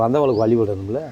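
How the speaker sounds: normal talking voice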